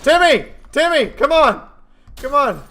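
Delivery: put-on high, loud urgent call to a child. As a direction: yell for the kid to hurry. Calling the game child.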